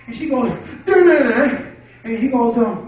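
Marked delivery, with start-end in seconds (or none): 0.8-1.6 garbled mumble. Acted. Woman repeats unintelligible words.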